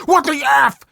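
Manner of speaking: very high, loud mock outrage; imitating a furious dad yelling; an angry dad's high shout; retold yell. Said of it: "He started yelling things like".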